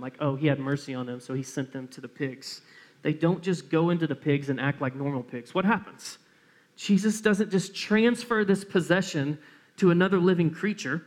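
normal talking voice

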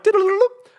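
high, loud sing-song beeps; comic high-pitched dialling noise; imitated phone dialling. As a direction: trill out quick keypad tones.

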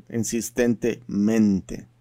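slow Spanish "insistentemente", leaning on "mente". Shown as a demonstration. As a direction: drag out "insistentemente" so "mente" rings. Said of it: "that incessant and lamenting mente sound".

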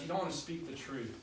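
usual speaking voice

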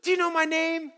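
put-on high, hopeful kid question; a light girl's voice testing him; imitating a girl. Camp girl asks pastor if he remembers her, high voice.

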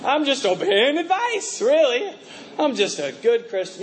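high, breezy mock self-satisfied voice; smug agreement. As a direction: play the smug churchgoer, lightly. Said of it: "you're like".

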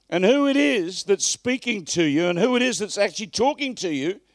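loud, high, impassioned sermon delivery; fiery pulpit preaching; full-force preaching. Acted.

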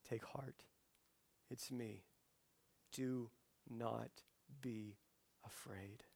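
very soft, spaced-out words; hushed, low, calming voice; gentle reassurance. Acted. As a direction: comfort them quietly, pausing between phrases. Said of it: "Jesus is right here standing before us and saying".

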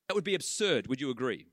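normal talking voice

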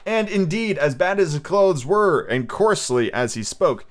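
plain reading voice

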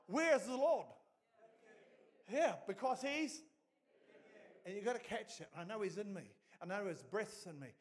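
loud, high, driving preacher voice; rising fervent sermon cadence; full-force preaching. Acted. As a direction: preach it hard to the whole room.

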